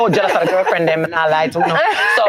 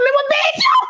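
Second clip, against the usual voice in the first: very high mock threat; screaming. Mother threatening her teenage son, high mock yell. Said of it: "And I'm screaming like that".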